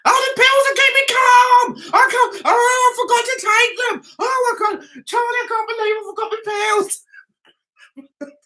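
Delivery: high, shouting, near crying; hammed-up panic about the pills, voice cracking; panicked wail. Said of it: "the crew opposite of me are saying".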